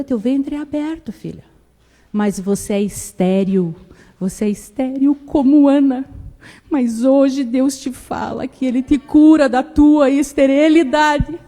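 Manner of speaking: a grandmother proclaiming her granddaughter healed, loud and solemn; prophetic declaration. Retold or imitated.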